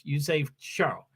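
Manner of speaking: "Charles" with soft sh and silent s; French Charles. Shown as a demonstration. Streamer teaching viewers Charles in French. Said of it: "that's how you say Charles in French".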